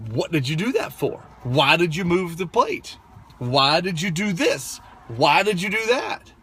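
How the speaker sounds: mildly acted angry telling-off; retold yelling. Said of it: "I'm not doing it in a calm way".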